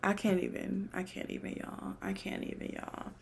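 fading to an unvoiced whisper, at a loss for words; a hushed, exasperated I can't even; breathless disbelief. Real, not acted. Speechless exasperation.

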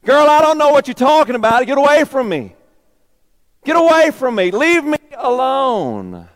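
loud, high, panicked brush-off; frantic denial. Acted. Peter shouting at the servant girl, panicked denial. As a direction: shove the accuser away in a panic.

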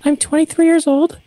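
put-on high, bright young graduate. Shown as a demonstration. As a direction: pitch up to sound young. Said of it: "this is how you sound young".

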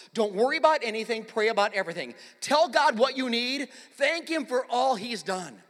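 loud, emphatic exhortation; forceful preacher, slowing on the final commands; full-force preaching. Real, not acted. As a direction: proclaim each command to the congregation.

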